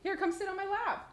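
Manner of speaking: high, soft invitation. Real, not acted. Inviting a young child over.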